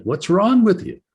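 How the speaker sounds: a mild mock of the wife, high and insistent; wife insisting. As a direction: press the question like a worried wife. Wife persists, retold.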